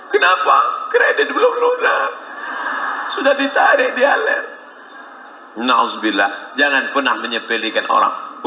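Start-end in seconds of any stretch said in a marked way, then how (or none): 0.0-4.4 high wailing complaint; mock crying daughter. Daughter coming home in tears to her mother, high wail. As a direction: whine like a tearful new bride. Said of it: "anak pulang nangis".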